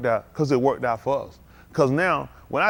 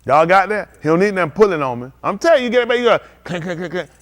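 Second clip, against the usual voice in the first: ordinary delivery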